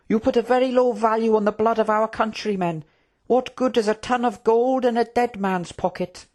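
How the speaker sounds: put-on high-pitched, indignant young royal; earnest prince. Prince challenging the Trook over war, high and indignant. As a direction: question them with high, principled concern.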